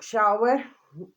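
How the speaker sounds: normal talking voice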